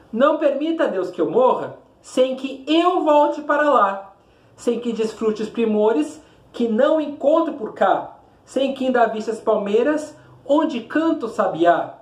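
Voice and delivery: measured, rhythmic declamation; poem recitation. Shown as a demonstration. Teacher reading the poem aloud to students, declaiming.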